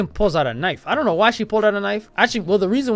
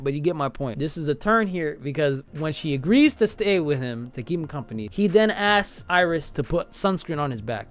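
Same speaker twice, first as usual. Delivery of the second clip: ordinary talk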